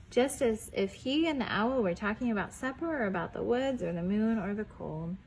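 ordinary delivery

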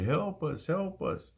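high, pleading call at normal volume; imitated panicked cry, pitch climbing on the repeat; mock cry for help. Disciples terrified by a ghost on the lake, mock cry for help. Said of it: "they cried out very loudly".

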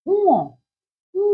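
উ drawn out as a long oo gliding into o; careful pronunciation. Shown as a demonstration. Teacher models উ for pupils to repeat, carefully drawn out. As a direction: say উ slowly as "oo-o". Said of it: "আমি উচ্চারণ করব".

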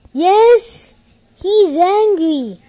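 high, loud, drawn-out delight; loud, slow crow of victory; gleeful triumph. Real, not acted. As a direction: cheer at the opponent's anger. Delighted opponent is angry.

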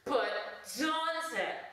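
very high put-on plea; imagined viewer interrupting. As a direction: call the name way up high, as a pestering viewer. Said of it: "I hear you say once more".

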